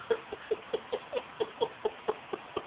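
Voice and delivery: breathy mocking laugh, high and soft; real laugh. Real, not acted. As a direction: burst out laughing at something ridiculous. Laughing at Shao Kahn's acting.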